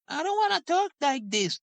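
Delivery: put-on high, whining little boy; whiny kid voice. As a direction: mimic a sulky child, way up high. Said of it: "he brings his Canadian thing".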